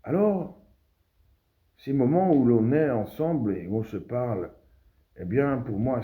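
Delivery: high, loud orator's swell; ringing declamation. Acted. Orator relaunches the address.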